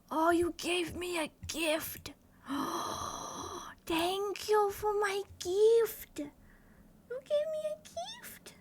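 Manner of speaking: slow, high, gushing thanks; touched gratitude. Real, not acted. Thanking a viewer for a gift, touched high gushing. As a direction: draw it out, high and overwhelmed.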